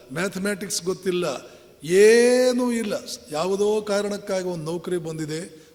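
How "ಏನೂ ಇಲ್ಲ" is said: loud emphatic dismissal. Real, not acted. Listing people's lack of worth.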